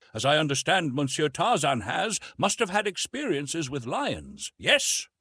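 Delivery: a light hint of a curious officer; French officer. A narrator's reading. Narrator voices French officer.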